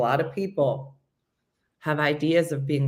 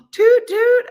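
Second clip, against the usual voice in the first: high, loud toot-toot; playful train whistle; 11 dB louder than usual. Pretend. Playing conductor on a train, high loud toot. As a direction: imitate a train horn brightly and loudly.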